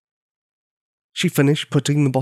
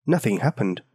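plain reading voice

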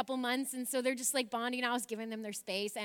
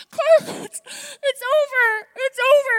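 much higher and louder, crying through the words, slowing down; 14 semitones higher, 17 dB louder, slower than usual. Retold or imitated. Telling her husband the ordeal is over while sobbing. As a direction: sob it out.

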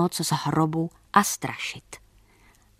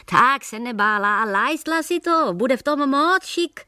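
high, sing-song hawker's cry, much higher; mocking peddler's call; 7 semitones higher than usual. Baker mimics peddler calling to women customers, high mocking hawker's cry. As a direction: ham up a market peddler hawking his wares. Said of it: "pekař napodoboval židovo volání".

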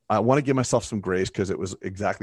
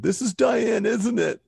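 put-on high, upset little-girl voice, borrowed for the retelling; imitating his young daughter; 13 semitones higher, slower than usual. Dad retelling his daughter's words, in a high upset child voice. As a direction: pitch way up like a distressed nine-year-old. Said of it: "I'll make up a name".

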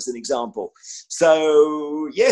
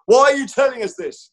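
Paxman impression; 8 semitones higher, 5 dB louder than usual. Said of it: "all the trainees wanted to be Paxman".

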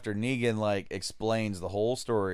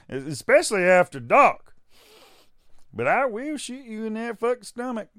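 backwater Southern; 6 semitones higher, 5 dB louder than usual. Acted. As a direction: play a menacing yokel with a raised voice. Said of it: "hear him as this, like, ultra backwater motherfucker".

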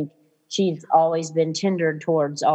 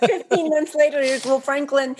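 excited; 8 semitones higher than usual. Real, not acted. Announcing surprise baby.

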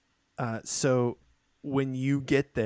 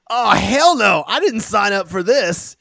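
panicked soldier; 9 semitones higher, 9 dB louder than usual. Acted. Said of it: "is like".

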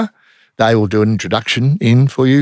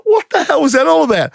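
much higher, a puzzled crook's voice. Retold or imitated. Crook demanding an explanation from the undercover cop, much higher. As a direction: play the baffled crook. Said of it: "the crook's like".

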